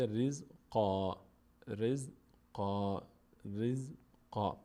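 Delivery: slow رزقا stopping on a long aa; pausal ending. Shown as a demonstration. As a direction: stretch رزقا as riz-qaa, no n. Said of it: "روی این کلمهٔ رزقا وقف کنیم".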